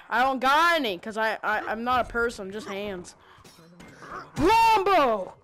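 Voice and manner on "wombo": shouted callout. Real, not acted. Combo attack callout.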